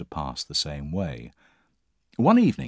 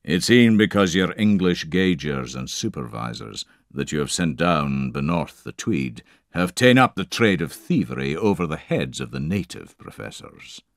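firm, lightly Scottish drover; a hint of Scots, steady and sure; Scottish drover Campbell putting the landlord in his place, Scottish accent; 4 dB louder than usual. Scottish drover rebukes the English.

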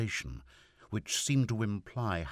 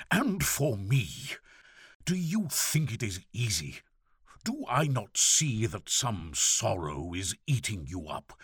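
higher, impassioned outburst; harsh, overwrought; 5 semitones higher, 3 dB louder than usual. A narrator's reading. Father demanding his daughter's hidden sorrow, harsh and overwrought. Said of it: "His harsh voice shook, as if something had snapped".

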